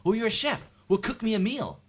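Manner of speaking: high, loud mock-eager demand; put-on presumptuous guest; pushy request. Guest learning the host likes cooking, high loud mock demand. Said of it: "and then I said".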